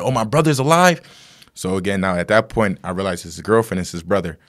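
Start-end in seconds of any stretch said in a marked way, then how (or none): 0.0-1.0 higher, louder incredulous question; acted disbelief, pitched up; retold astonishment. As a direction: imitate sudden hopeful surprise. Acting driver learning brother lives.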